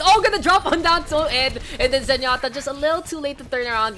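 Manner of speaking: excited call. Acted. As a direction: jump on the big play. Sudden play call.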